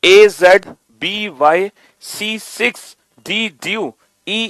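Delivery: slow, rhythmic recital of letter pairs; slow reading demo. Shown as a demonstration. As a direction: chant each pair clearly, unhurried. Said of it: "मैं एक बार और पढ़ के दिखाता हूं".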